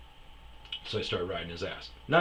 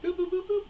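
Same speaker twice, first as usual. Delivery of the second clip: high imitated beeping; keypad beeps; faster than usual. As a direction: mimic the gate code tones.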